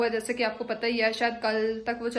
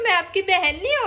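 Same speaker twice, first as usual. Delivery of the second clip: imitating little sister; 7 semitones higher than usual. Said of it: "अब इसको काम है ना, तो".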